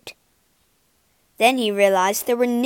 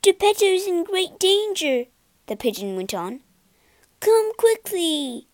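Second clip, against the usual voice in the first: urgent pigeon; 8 semitones higher than usual. Acted. Pigeon warns Pinocchio of danger.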